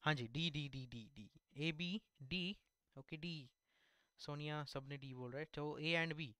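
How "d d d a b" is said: usual speaking voice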